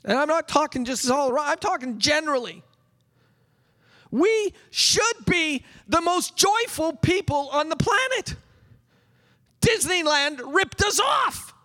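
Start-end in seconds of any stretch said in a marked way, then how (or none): 4.1-11.5 excited preaching. Real, not acted. Preacher rising in pitch.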